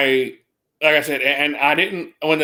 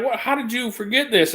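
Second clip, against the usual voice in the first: high, incredulous mock scolding; scolding boss; 7 semitones higher than usual. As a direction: needle her with disbelief. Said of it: "She's like".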